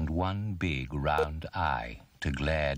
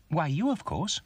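high little elephant voice; Bump; 10 semitones higher than usual. A narrator's reading. Little elephant answers the voice, high-pitched. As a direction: answer brightly, much higher. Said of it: "said Bump".

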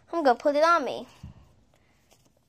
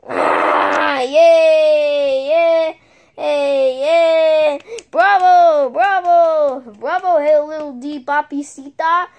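loud high cheering; cheering crowd; 4 semitones higher, 10 dB louder, slower than usual. Pretend. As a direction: cheer and clap for the star. Audience cheering after show.

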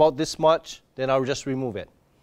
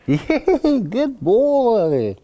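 put-on yay pitched high, then gushing good boy; happy dog praise; 7 semitones higher, much slower than usual. Shown as a demonstration. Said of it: "I'm super happy".

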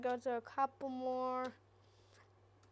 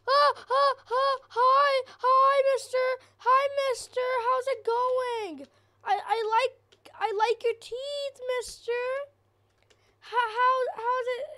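much higher and louder, panicky; terrified greeting; 9 semitones higher, 8 dB louder than usual. Real, not acted. Gamer greets a toothy monster in panic. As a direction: babble politely through real terror.

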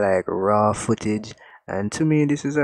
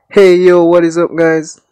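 loud, high hey yo what is up; projected voice; 8 semitones higher, 10 dB louder than usual. Shown as a demonstration. Testing the pop filter, he projects loudly to viewers. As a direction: project it out big to the room. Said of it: "If I project my voice louder".

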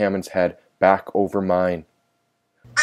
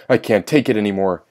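plain reading voice

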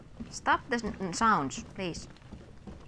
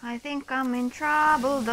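half-joking panic, pitched up; mock worried; 6 semitones higher than usual. Real, not acted. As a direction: play up the worry a little. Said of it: "repeated with more alarm".

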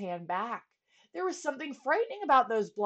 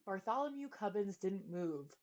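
ordinary delivery

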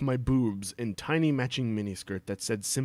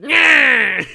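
high loud nyah shout; mock jump-scare yell; 11 semitones higher, 14 dB louder than usual. Draco jumping from behind a wall, mock yell. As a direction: leap out with a silly shriek. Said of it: "He was just there like".

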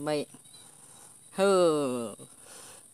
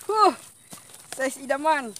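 excited; 8 semitones higher, 7 dB louder, slower than usual. Real, not acted. Admiring the caught fish.

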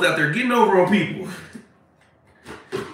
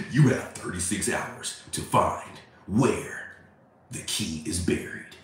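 Saw; 9 semitones lower, 8 dB quieter than usual. Acted. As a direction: menace softly, drawing out the pauses. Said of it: "it's like the movie Saw".

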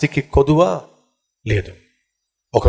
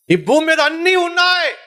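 high exclamation; 13 semitones higher than usual. Real, not acted.

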